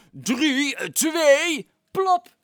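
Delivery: high, loud counting; megaphone countdown. Acted. The megaphone man counts down the last seconds, loud high countdown. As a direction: count down hard through a megaphone. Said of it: "De meneer met de megafoon riep".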